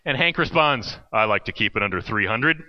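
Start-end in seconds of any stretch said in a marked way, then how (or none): 1.1-2.6 lower, deadpan big guy; big teen's voice. Retold or imitated. Hank answering the camp leader, lower and deadpan. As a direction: drop low like a hefty wrestler.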